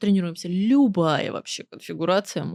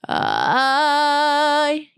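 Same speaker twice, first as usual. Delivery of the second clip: thick chest voice; 5 semitones higher than usual. Shown as a demonstration. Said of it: "уходите на толстое".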